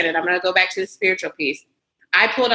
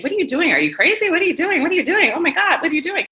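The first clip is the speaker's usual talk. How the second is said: high, incredulous repeated questioning; alarmed disbelief; 10 semitones higher, faster than usual. Retold or imitated. People around her questioning the move, high alarmed disbelief. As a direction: echo the worried crowd, voice climbing. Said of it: "I had all these people around me saying".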